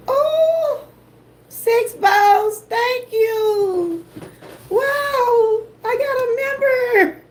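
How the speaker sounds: high, tearful squeal of delight; overjoyed squeal. Real, not acted. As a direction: gasp and squeal with surprised joy. Surprised by new channel member.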